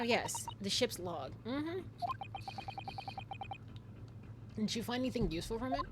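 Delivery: soft, measured gentleman detective; a hint of a prim detective; lightly voiced detective. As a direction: read it like a polite sleuth. Game voices read with character dialogue.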